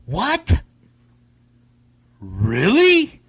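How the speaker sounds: "What?" leaping high, then a drawn-out rising "Really?"; two separate incredulous questions, each swooping up; mock surprised questioning. Shown as a demonstration. Host echoing the game's title, "What?" and "Really?" as amazed rising questions. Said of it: "It's more like "What really?".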